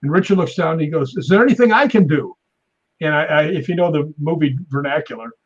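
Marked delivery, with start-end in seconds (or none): 1.2-2.3 high, louder imitated worried director; mock-urgent concerned question; acted anxious offer. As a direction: plead to help, pitched up.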